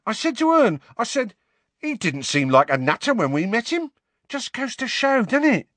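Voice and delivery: high, chirpy Cockney gossip; gabby Cockney bus conductor; chatty Cockney conductor. A narrator's reading. Stan voiced in the story.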